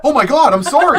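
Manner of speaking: high, loud mock-horrified apology; panicked apology. Mom apologizing to son for permanent slip, mock-panicked. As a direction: imitate someone aghast at their own mistake.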